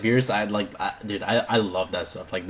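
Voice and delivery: ordinary talk, nothing marked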